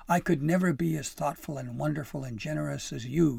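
loud, impassioned outburst; bursting out. A narrator's reading. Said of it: "he burst".

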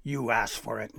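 plain reading voice

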